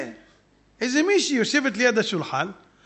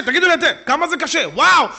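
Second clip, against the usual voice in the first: ordinary talk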